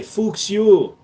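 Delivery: Fuchs you as "fooks you", falling; mock German "Fuchs you" with a pause after; German pun. Shown as a demonstration. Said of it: "we have to say it this way".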